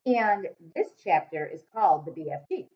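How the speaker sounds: usual speaking voice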